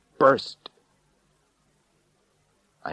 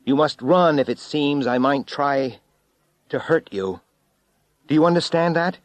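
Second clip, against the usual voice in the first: loud, higher urgent warning; urgent call over wind; 8 semitones higher, 6 dB louder than usual. A narrator's reading. Narrator warning Richard over the wind, loud and urgent. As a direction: shout the warning into the gale. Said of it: "I said over the rising wind".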